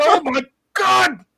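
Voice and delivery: loud, high-pitched mock cry of disbelief; hammed-up wailing exclamation; retold shocked outcry. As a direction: relive the hysterical outburst, high and loud. Reliving hysterical reaction in car.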